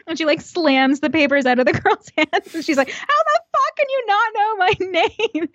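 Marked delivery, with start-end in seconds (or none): 2.9-5.5 high, mock furious shriek; imitating a coworker screaming; mock furious outburst. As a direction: freak out at her in a mocked rage. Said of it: "freaks the fuck out".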